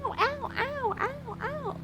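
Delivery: tiny, very high mock yelps; soft, squeaky little pretend cries of pain; pretend ouch. Streamer pretending cheeks are pinched, squeaky mock yelps.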